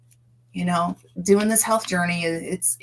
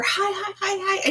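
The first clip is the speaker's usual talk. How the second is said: squeaky greeting; 11 semitones higher than usual. Retold or imitated.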